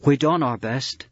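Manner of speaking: ordinary delivery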